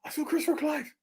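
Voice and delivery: high, hushed squeal of glee; small high-pitched fan squeak; giddy confession. Real, not acted. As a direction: blurt it softly in a high, excited voice. Gleeful announcement after going off topic.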